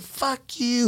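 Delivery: put-on high, squeaky voice of a defiant old woman; old lady's retort. Cop retelling the woman cursing back at him. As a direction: spit it back like the angry old lady. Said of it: "she's like".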